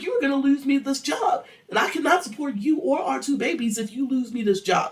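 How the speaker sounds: high, heated scolding; going off. Acted. Wife scolding husband over her job, going off. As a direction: tear into him in a high voice. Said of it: "So she goes off".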